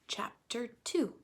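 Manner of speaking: whispered chapter title. Real, not acted. Teacher whispering the chapter heading to her class. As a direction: breathe the heading softly.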